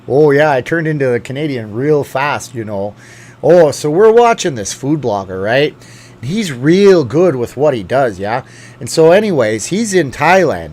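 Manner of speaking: hammed-up Canadian accent, bouncy and loud; bad Canadian accent. Shown as a demonstration. Joking to viewers about turning Canadian, bad Canadian accent. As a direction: lay on a cartoonish Canadian lilt. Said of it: "I can't do the Canadian bad accent for long".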